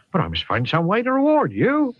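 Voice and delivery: high, insistent West Country voice; a thankful Somerset farmer insisting. Acted. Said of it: "I think he's from the Southwest".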